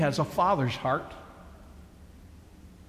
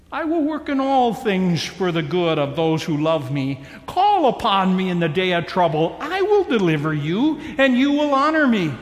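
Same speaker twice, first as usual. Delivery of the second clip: building promise; 4 dB louder than usual. Acted. God's promise rising to climax.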